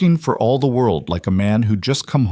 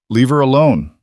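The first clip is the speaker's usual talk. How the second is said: louder, firm protective warning; 4 semitones higher, 6 dB louder than usual. A narrator's reading. Caleb shielding Stella from her menacing boss, louder and firm. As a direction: step in front of her and warn him off.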